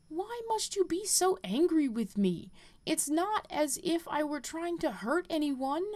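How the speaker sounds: a hint of a hurt girl pleading; hurt young girl. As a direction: protest like a scolded child. Girl answers the angry mentor.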